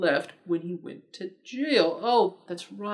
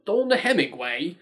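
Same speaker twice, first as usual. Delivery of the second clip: British accent attempt; 3 semitones lower, 5 dB louder than usual. Shown as a demonstration. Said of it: "I probably got that accent totally off".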